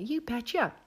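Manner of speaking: imitated Minnesota-style accent; Cheryl Lipinski. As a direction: chirp it in a put-on Upper Midwest accent. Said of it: "a Midwest version".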